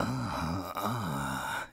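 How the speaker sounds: low, mock "uh, uh" grunts; imitating his grunt. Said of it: "him going".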